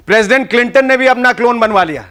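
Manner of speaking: ordinary talk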